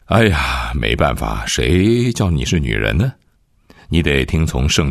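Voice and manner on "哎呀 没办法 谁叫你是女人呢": resigned sigh to oneself. Acted. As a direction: sigh it like giving in to your lot. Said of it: "如果你对自己或对女儿说".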